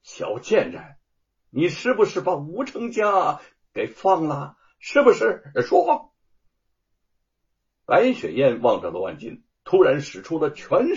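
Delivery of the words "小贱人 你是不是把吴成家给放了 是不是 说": escalating rage, pitch shooting up; furious cursing. A narrator's reading. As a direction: accuse her and force an answer. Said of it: "指着白雪燕骂道".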